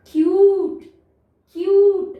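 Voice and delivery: क्यूट called out loud and clear, said twice; loud demo word. Shown as a demonstration. Teacher answering her own question for kids, loud demo. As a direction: announce क्यूट loudly to the class. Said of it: "और ई लगाने से क्या हो जाएगा?".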